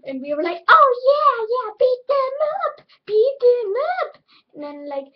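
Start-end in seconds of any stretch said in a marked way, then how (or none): 0.7-4.2 high, loud cheering; yelled high cheer, slowing on the last words. Retold or imitated. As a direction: egg him on, shrieking. Cheering on the brother.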